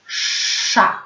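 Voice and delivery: "sza" with a long hushing sz before the vowel; drawn-out SZ. Shown as a demonstration. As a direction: model the syllable "sza", sz held long. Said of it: "Pamiętamy język wysoko".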